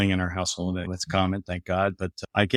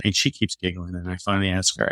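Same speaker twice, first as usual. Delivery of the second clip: ordinary talk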